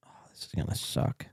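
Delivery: dread, whispered under breath. Real, not acted. Dreading the game result.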